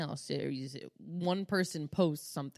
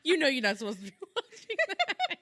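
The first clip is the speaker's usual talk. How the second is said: higher, laughing through a mock scold; 10 semitones higher than usual. Real, not acted. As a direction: giggle while scolding. Laughing telling kids off.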